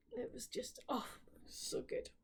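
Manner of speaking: breathless whisper of delight. Real, not acted. Reviewer whispering to viewers how good the book was. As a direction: whisper it like a secret treat.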